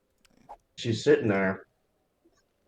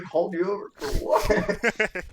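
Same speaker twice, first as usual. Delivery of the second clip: incredulous; 10 semitones higher than usual. Retold or imitated. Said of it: "I was like".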